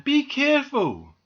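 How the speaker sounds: loud, high-pitched caution; warning call. A narrator's reading. Doll warns squirrel on the washing line, warning call.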